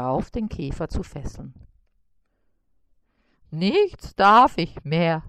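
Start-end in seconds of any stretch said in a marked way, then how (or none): 3.5-5.2 slow, lightly whining spider; mild whine. A narrator's reading. Spider sulking at being bossed, slow mild whine. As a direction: moan it slowly. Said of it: "jammerte er".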